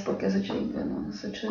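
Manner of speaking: whispered self-correction; unvoiced, under the breath; muttered aside. Real, not acted. Preacher whispering to herself to fix the date, hushed.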